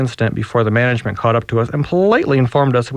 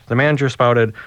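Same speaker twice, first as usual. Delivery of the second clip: usual speaking voice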